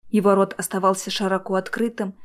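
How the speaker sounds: plain reading voice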